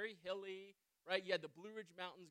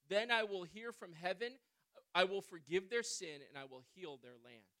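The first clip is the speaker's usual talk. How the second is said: scripture reading; 5 dB louder than usual. Acted.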